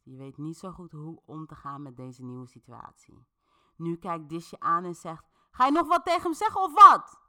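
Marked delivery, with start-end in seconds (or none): 5.5-7.2 high, fast, very loud shout; furious demand. A narrator's reading. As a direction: yell it at your companion, demanding backup. Dis angrily turns on companion.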